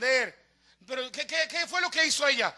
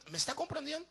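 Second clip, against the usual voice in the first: normal talking voice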